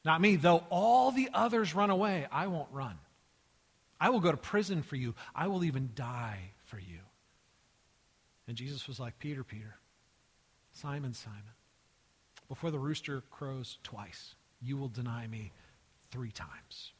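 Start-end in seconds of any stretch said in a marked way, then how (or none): 0.0-7.0 emphatic, bold declaration that eases off at the end; boastful vow. Acted. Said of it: "instead of boasting". 9.2-16.9 soft, low, measured warning; hushed and grave prediction; quiet foretelling. Acted. Jesus gently foretells Peter's denial.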